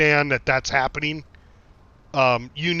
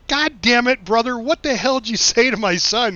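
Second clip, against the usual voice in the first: high, mock-outraged God damn it; exasperated; 7 semitones higher than usual. Asks brother what he told son, mock outrage. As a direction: throw it at the brother in disbelief.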